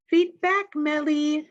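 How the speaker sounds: bright high call across the playground. A narrator's reading. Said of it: "she calls out".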